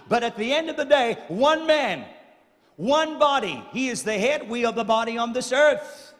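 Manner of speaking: high, loud and fast, building to a shout; the preacher at full force on one man, one body; preaching climax. Acted. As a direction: cry it out to the congregation.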